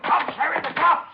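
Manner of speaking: very high, loud alarmed yell; panicked warning. Acted. Suspect inside warning his partner, panicked yell. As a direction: scream the alarm to the others.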